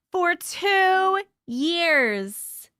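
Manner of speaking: very slow, drawn-out amazement; marvelling, long-held words; amazed emphasis. Real, not acted. Amazed repeat of membership length.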